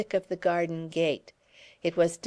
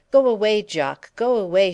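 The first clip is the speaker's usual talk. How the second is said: Edie crying out through the window, raised voice; 3 semitones higher, 8 dB louder than usual. A narrator's reading. Edie crying out to Jock through the window, loud and upset. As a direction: call it out high and loud to send him off. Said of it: "she cried".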